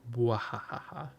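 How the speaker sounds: over-the-top villain bwahaha; evil laugh. Pretend. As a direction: cackle like a cartoon villain. Villain gloating about gang plan.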